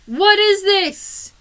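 slow, loud, disbelieving; hammed-up outrage, high and loud; indignant outrage. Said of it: "I'm just sitting there going".

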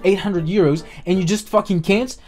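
ordinary delivery

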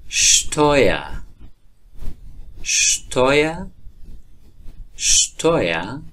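شتویا with sh-t onset, drawn out; st said as sht. Shown as a demonstration. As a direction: say شتویا as shtoya, slow.

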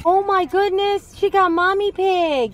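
high, loud and slow delight; excited. Real, not acted. Child got the toy.

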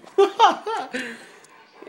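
high, loud burst of laughter. Real, not acted. Laughing at filming own room.

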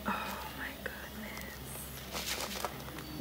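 hushed, as if something precious is inside; awed whisper. Real, not acted. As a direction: breathe the words almost silently. Opening the package in awe.

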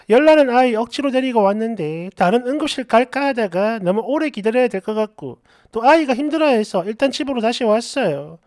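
ordinary delivery

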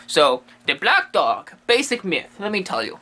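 ordinary talk

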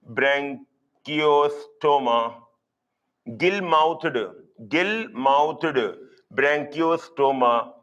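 BRANCHIOSTOMA very slow, in separate chunks; slow breakdown. Shown as a demonstration. Teacher giving students the example to remember, slowly broken down. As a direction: break "Branchiostoma" apart with pauses between the parts.

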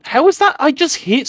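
high, loud outburst of disbelief; indignant disbelief. Real, not acted. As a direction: protest a hit that didn't count. Hit was not counted.

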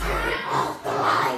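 slow breathy demon rasp; possessed demon voice. Pretend. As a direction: drag it out like a possessed man. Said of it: "Like he possessed and got a demon in him".

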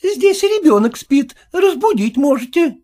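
high, loud urgent plea; anxious nanny. Acted. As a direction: keep him from the sleeping child. Nanny protects child.

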